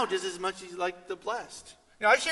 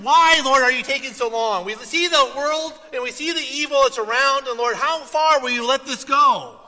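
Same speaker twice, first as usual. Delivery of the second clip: loud, higher lament; anguished cry to God; 7 semitones higher, 10 dB louder than usual. Acted. Believer crying out to God, loud anguished lament.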